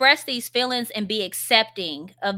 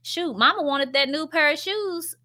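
put-on mama talking to kids; 7 semitones higher than usual. Shown as a demonstration. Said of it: "you can say that to your kids".

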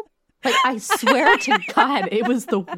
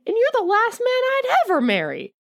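raised, higher hammed-up outcry; mock enraged cry; 11 semitones higher than usual. A narrator's reading. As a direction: cry it out like a wronged belle. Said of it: "she cried out, shaken and enraged".

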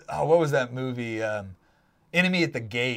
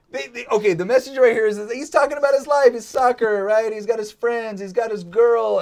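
louder, higher, eager explaining; louder and higher with excitement; excited; 6 semitones higher, 4 dB louder than usual. Real, not acted. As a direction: pump it up as you explain the ad.

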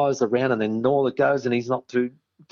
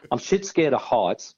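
normal talking voice

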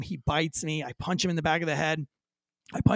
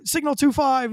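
high, raised call for backup; re-enacted urgent radio yell; yelled radio code; 8 semitones higher, 4 dB louder than usual. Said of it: "I yell really loud".

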